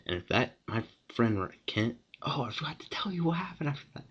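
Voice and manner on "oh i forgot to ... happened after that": quick high whispered aside; whispering. Real, not acted. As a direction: whisper it fast, like a side note. Sudden aside to viewers.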